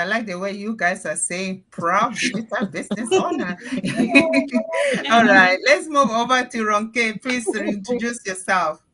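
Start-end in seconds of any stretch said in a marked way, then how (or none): 3.6-5.1 squealing high burst of laughter. Real, not acted. Host amused by guests' phrase, high squealing laugh. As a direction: let out a bright squeal of laughter.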